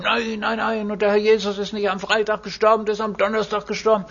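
high, fast, mock-indignant protest; mocking the zealous brothers. Preacher mimics the brothers who argue about Good Friday, high and fast. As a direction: parrot the agitated objectors in a high voice. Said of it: "dass es da Brüder gibt, die sich dagegen aufwärmen".

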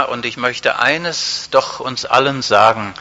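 ordinary talk, nothing marked

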